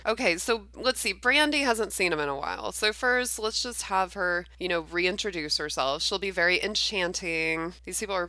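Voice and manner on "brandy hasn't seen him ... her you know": ordinary talk, nothing marked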